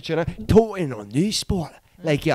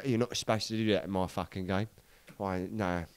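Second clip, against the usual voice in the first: much softer, low Cockney grumble; imitating old gangster; 6 semitones lower, 11 dB quieter than usual. Old gangster grumbling about the victim, low and soft. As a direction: growl it low like a London villain. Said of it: "the voice on him".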